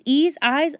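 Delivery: ordinary delivery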